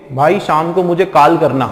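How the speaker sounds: "कॉल" said as "काल"; mistaken aa vowel. Shown as a demonstration. Teacher mimicking a wrong request to a friend, long aa vowel. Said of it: "बोलते हो आप ऐसे".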